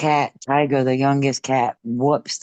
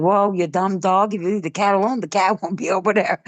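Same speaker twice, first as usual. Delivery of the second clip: scolding the dog; 6 semitones higher than usual. Retold or imitated. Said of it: "I was like".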